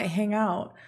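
usual speaking voice

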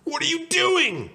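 very high, loud, stretched yell; high-pitched horrified holler; alarmed shout. Acted. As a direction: shout in outraged alarm, voice shooting up. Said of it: "shouted Arnie".

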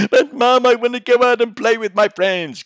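put-on high, loud pleading kid voice; whiny child. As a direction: beg mum like a sulky child. Child pleading with mum, acted.

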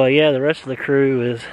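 ordinary talk, nothing marked

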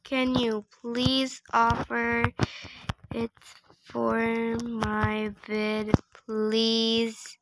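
slow, word by word with gaps; slow dictation. Real, not acted. Player typing a request to another trader, slow dictation. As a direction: sound it out as if typing. Sounding out a typed message.

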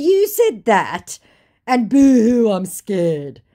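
high, loud, sarcastic fake fear; mocking. Woman mocking the scammer's threat, sarcastic. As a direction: jeer at an empty threat.

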